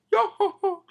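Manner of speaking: very high, loud put-on laugh; hammed-up character laugh; Brook laughing. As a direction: cackle it out on each syllable. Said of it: "even Brook's laughing at".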